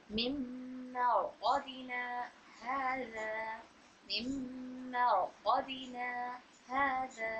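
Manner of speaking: soft, slow recitation, word by word; slow Quran recitation. Shown as a demonstration. Teacher reciting slowly for practice, soft. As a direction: recite मिम्मा रक़दना हाज़ा softly, with long pauses. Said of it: "यहाँ पर कलकलाए कुबरा हो रहा है".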